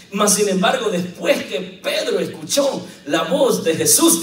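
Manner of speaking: ordinary talk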